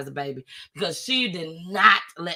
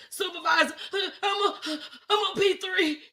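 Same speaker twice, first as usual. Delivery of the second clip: high, halting sobbed report; retold tearful stammer, pitched way up; boohoo crying; 10 semitones higher, 4 dB quieter than usual. Stranded woman reports to her supervisor, sobbing. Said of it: "I'm still boohoo crying".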